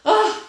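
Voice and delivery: high, loud "ugh" of exasperation; exasperated groan. Real, not acted. Fed up with costly paperwork, exasperated groan.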